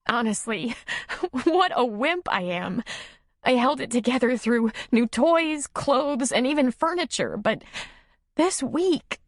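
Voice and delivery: high-pitched, weepy self-reproach; tearful sniffling. A narrator's reading. Nora admitting she finally broke down, sniffling. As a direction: let it spill out high and tearful. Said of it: "She sniffed".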